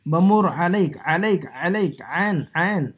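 "علیک" opening on a squeezed ayn, repeated; ayn from the throat. Shown as a demonstration. Teacher drilling the letter, throaty ayn in "علیک". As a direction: press the throat for each "علیک" and "عین". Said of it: "حلق سے".

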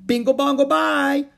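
very high, loud chant; shouted. A narrator's reading. Howie shouting nonsense to make noise. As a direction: yell nonsense just for noise. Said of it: "the others joined in with great delight".

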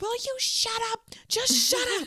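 high-pitched mock exasperated scolding; imitated snapping. Sally fed up with the bossy ex, high-pitched mock snapping. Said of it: "like, snaps".

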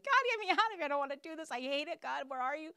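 high-pitched mock tantrum to God; kicking and screaming. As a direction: wail a complaint upward, loud then easing off. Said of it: "we're kicking and screaming like".

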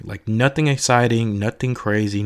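ordinary talk, nothing marked

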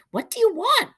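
put-on a startled old woman fussing; high fussing old lady. Old lady questions boys.

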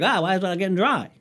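high, quick comic whine; hammed-up rushed bafflement, pitched right up; mock stressed. Owner whose dryer won't dry, mock stressed. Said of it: "it was a lot more stressful".

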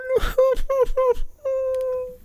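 high mock giggle; a squeaky mocking laugh; falsetto giggle. Host mocking the spokeswoman, high squeaky giggle.

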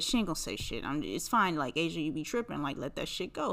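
a quiet self-scolding mutter; hushed reasoning with herself. Retold or imitated. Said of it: "And I said".

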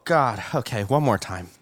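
normal talking voice

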